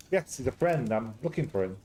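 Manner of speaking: usual speaking voice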